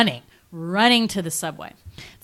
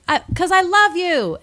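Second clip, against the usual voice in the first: high-pitched calling out of love; heroine's declaration; 13 semitones higher, 4 dB louder than usual. Retold or imitated. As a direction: declare it across a crowd, put-on high. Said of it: "So she's like".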